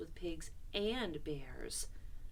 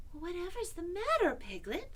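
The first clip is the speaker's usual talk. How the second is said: lightly voiced, higher-pitched puzzled boy; Christopher Robin; 10 semitones higher than usual. A narrator's reading. Christopher Robin, just getting up, asks Piglet, puzzled. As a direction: ask with mild, high-pitched concern. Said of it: "said Christopher Robin".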